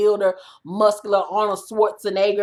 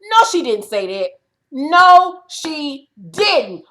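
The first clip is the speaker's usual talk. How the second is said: stunned, repeated no she didn't; can't believe what she heard; disbelief; 4 semitones higher, 4 dB louder, slower than usual. Real, not acted. Vlogger reacting to Kim's racism remark, stunned disbelief. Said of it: "I had to rewind that shit and say".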